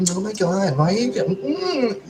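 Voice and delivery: high, drawn-out mock scolding mom voice; mock jealous mama. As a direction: play a jealous mother tutting at you, slowly. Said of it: "Mama's gonna get all like".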